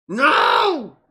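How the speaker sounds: loud, high yelled refusal; frustrated outburst. Real, not acted. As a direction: yell it out in exasperation. Venting frustration at software mishap.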